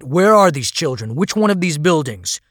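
loud, urgent questions; captain alarmed about a thousand children; alarmed demand. A narrator's reading. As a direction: demand answers in shock.

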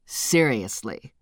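low, slow deadpan answer. Acted. Narrator deadpan reply.

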